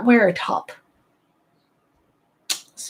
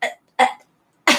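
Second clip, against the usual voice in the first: halting, stuttering parody; Bella. Acted. Imitating a Twilight heroine answering questions, mock stammer. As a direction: stammer on the first syllable of the name. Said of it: "if Bella from Twilight".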